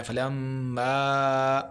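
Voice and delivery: فلما slow, with the ميم held long and nasal; nasal ghunna demo. Shown as a demonstration. Quran teacher demonstrating ghunna on فلما, slow. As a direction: stretch the م in فلما through the nose. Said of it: "فلما پہ ہم نے پھر غنہ کرنا ہے اور مد کرنی ہے".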